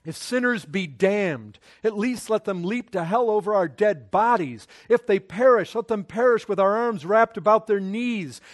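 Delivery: a loud, ringing recital of the quote; preached quotation. Acted. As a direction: declaim it to stir the hearers. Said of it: "Spurgeon's quotes".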